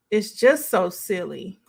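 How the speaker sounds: ordinary talk, nothing marked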